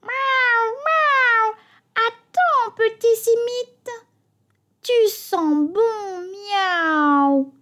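high, slow mewing cat chorus; calling cats. A narrator's reading. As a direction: meow and coax like hungry street cats. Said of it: "Ils appellent".

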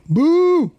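high, drawn-out mock boo. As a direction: boo the American hop playfully. Booing American Saaz.

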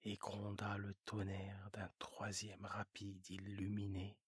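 low storyteller whisper; whispered narration. A narrator's reading. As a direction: hush the third train.

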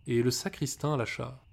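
plain reading voice

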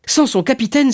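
high, elated cry; joyful exclamation. A narrator's reading. Young sailor realises he may be captain, joyful exclamation. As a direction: cry it out, lit up with joy. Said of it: "s'écria Dantès, les yeux brillants de joie".

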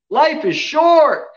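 loud, high preacher's cry; emphatic preaching. Real, not acted. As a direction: ring it out to the congregation. Preacher drives home his point.

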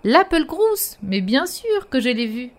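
high cheerful snowman voice. Acted. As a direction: greet like a jolly snowman. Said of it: "dit le bonhomme".

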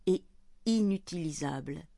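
usual speaking voice

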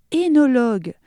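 énologue starting with a clear é; pronounced é. Shown as a demonstration. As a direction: open the word énologue on a bright é. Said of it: "car le O, E dans l'eau, se prononce é". (énologue prononcé avec un é)

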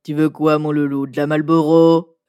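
low, loud raspy smoker; smoker's voice. Pretend. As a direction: play a chain-smoking lady. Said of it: "j'ai une voix de fumeuse".

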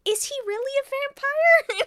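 high loud incredulous question. Retold or imitated. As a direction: ask it like you can't believe it. Incredulous self-quote.

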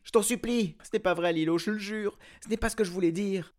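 high, loud, frantic begging; the princess pleading fast and high; desperate pleading. A narrator's reading. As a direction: beg him not to believe it.

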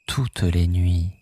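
normal talking voice

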